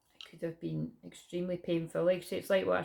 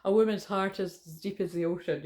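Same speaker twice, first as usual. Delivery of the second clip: a touch of film drama; Titanic quote voice; 3 dB louder than usual. Retold or imitated. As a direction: lightly quote the Titanic line. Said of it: "It reminds me of Titanic".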